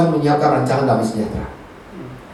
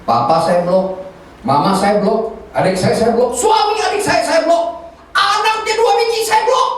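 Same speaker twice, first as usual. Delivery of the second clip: escalating list; 7 semitones higher than usual. Retold or imitated. Preacher confessing whom they blocked, in a comically escalating list. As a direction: pitch climbing higher with each item.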